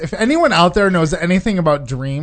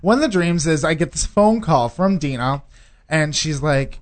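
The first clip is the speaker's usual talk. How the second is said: normal talking voice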